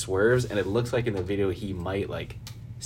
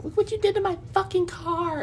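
high, shrill-free squeal of an outraged driver, much higher; mock angry girl. Imitating the furious driver in a high mock voice. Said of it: "The girl was like".

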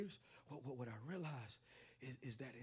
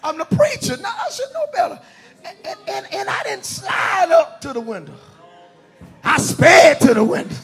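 high, very loud preacher shout; preaching at full force; 11 semitones higher, 32 dB louder than usual. Acted. Preacher telling congregation of his drive-through showdown, full-force shouting. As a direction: belt the story out to the whole congregation.

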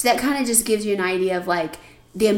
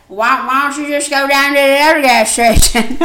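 louder, higher mock C J voice; imitating C J; 4 semitones higher, 10 dB louder than usual. Narrator imitating C J advising the sister on the phone. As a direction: do C J's clueless suggestion. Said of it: "I think that was accurate".

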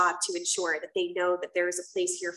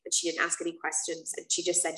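normal talking voice

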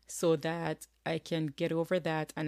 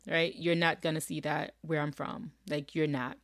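ordinary talk, nothing marked

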